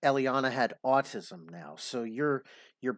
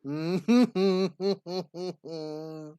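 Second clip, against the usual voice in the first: loud scoffing laugh; 6 semitones higher, 4 dB louder than usual. Real, not acted. Host laughs at caller's demand.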